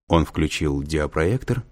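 ordinary delivery

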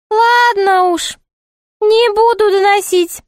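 very high, sing-song jester voice; put-on high jester voice; high-voiced jester. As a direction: play the court jester in a high, piping pitch.

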